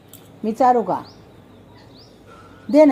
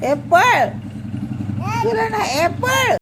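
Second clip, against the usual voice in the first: shooing; 12 semitones higher, slower than usual. Real, not acted. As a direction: chase it off with a sharp high call. Shooing away noisy pet.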